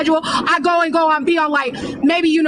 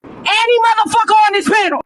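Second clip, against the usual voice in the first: real yelling; 5 semitones higher than usual. Real, not acted. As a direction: bark the challenge at everyone. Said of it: "I wanna apologize for yelling on this live".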